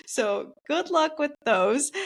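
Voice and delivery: laughing teasingly, slowly. Real, not acted. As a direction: chuckle through it. Teasing about tough sets.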